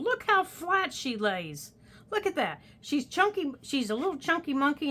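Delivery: cooing. Real, not acted. Cooing over the journal.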